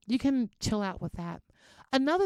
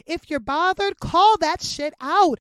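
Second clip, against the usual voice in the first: emphatic call-out; 6 semitones higher, 10 dB louder than usual. Real, not acted. Urging listeners firmly.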